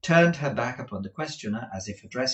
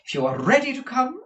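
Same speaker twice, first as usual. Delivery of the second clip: high, loud, proper; madam pitched well up; brisk Madame Mantalini; 9 dB louder than usual. Acted. Madam invites Kate to start, high and brisk.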